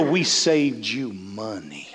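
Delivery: a cousin's smug bargain brag, mocked low and slow; imitating Jerry. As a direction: drop way down and stretch it out through a chuckle. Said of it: "and Jerry'd go".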